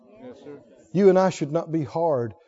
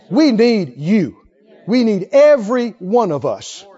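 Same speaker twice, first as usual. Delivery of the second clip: We need you said slowly, weighted; earnest drawn-out plea; slow appeal; 6 dB louder, slower than usual. Acted. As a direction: lean on each word.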